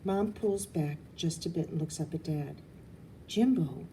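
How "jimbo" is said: ordinary delivery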